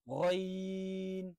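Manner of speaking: গইন held very long with throat vibration; long held letter. Shown as a demonstration. Teacher showing throat vibration, long held letter. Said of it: "কম্পন তৈরি হবে".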